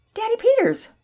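put-on high, loud little-girl greeting; delighted surprise. A narrator's reading. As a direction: call out to Dad in happy surprise. Delighted girl greets her dad.

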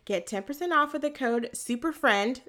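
normal talking voice

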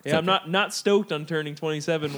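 ordinary talk

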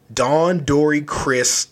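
normal talking voice

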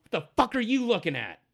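high, loud challenge; hot-headed halfling picking a fight; angry halfling. Acted. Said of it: "he says".

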